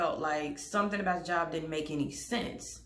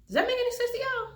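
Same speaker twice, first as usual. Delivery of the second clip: fast, squeaky, much higher disbelieving question; exasperated disbelief; faster than usual. Real, not acted. Asking viewers about the law office selling insurance, exasperated squeal. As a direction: throw up your hands at the viewers.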